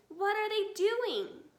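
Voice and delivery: high, slow worried question; frightened cry. A narrator's reading. Small foxes asking Dad in fear. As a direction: plead to know what's happening. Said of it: "cried the small foxes".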